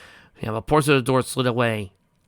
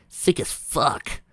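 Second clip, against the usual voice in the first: slow disgusted; 4 semitones higher, much slower than usual. Acted. Character voice in story.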